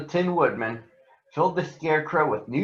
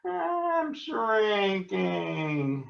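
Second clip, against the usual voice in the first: melting witch wail; 8 semitones higher, much slower than usual. Acted. Witch melts away.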